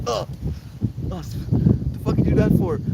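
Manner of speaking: high, outraged mock complaint; mock outraged whine. To the chat, playing offended after being spat at, high mock outrage. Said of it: "I'll be like".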